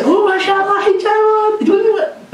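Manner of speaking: put-on very high, delighted coo to a little girl; cooing mashallah, pitched far up like talking to a toddler; praising parent. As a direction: sweetly admire her in the hijab. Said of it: "But you say".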